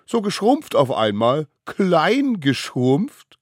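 high, gleeful mock concern; sniggering kid taunt, drawn out at the end; giggly teasing. A narrator's reading. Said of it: "gickerten sie".

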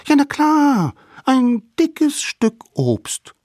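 very high, loud little voice; insistent littlest savage. A narrator's reading. Littlest savage defends calling the mammoth fruit, insistent. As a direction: insist brightly, slowing on the final words.

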